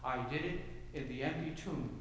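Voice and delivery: low, hushed voice as Jesus; quiet voice of Jesus. Acted. Preacher voicing Jesus to the congregation, low and hushed. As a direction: speak softly as the risen Lord.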